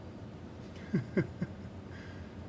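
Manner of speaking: soft, breath-only chuckle; laughter. Real, not acted. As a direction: chuckle quietly under the breath. Laughing at failed impression.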